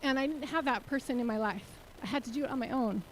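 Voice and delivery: getting emotional. Real, not acted. To the audience, recalling growing up without support, quietly emotional. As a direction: let the feeling pull the voice down. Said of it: "You can see I'm getting emotional about it".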